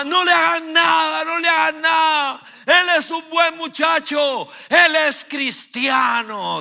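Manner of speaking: high, loud, slow pleading shriek; mother shouting at the police to spare her son; frantic mother. Retold or imitated. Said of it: "dijo mi mamá".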